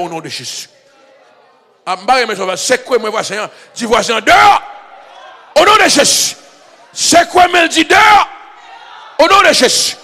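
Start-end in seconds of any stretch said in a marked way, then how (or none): 1.8-10.0 loud, high, rhythmic preacher cry; preacher commands the church to go tell it; shouted preaching. Acted. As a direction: drive each call home at full voice. Preaching climax, congregation called.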